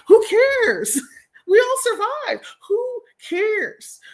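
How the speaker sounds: high, drawn-out "who cares"; incredulous squeal with a laugh; mock exasperation. Real, not acted. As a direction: throw it out high and dismissive. Mocking those who were right.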